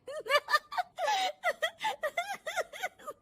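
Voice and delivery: high shrieking laugh at the TV. Acted. Daughter-in-law laughing hard at a TV serial, high laugh. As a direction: burst out laughing at a show.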